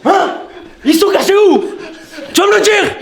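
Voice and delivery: loud, high, laughing demands; mock interrogation. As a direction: grill it for answers, laughing.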